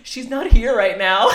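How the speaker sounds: put-on high, slow, loud innocent child; child covering up. Child lying to visitor at window, innocent. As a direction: imitate a kid passing on a fib.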